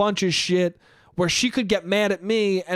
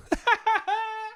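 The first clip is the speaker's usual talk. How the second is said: a high burst of relieved laughter; 3 dB quieter than usual. Real, not acted. Relief at being stood up.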